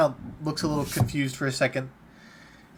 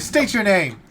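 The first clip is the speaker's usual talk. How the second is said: loud, higher command; barked order; 6 semitones higher, 6 dB louder, slower than usual. Acted. Officer demands a soldier's name, barking. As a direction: snap it out like a drill officer.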